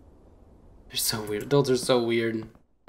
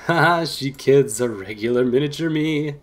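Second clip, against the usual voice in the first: mock laughing tease; 2 semitones higher, 5 dB louder than usual. Walter teases about his protege.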